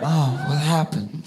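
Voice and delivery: soft, slow pretend sleepy voice; fake groggy waking. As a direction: act like you just woke up innocently. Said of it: "I gotta pretend to wake up".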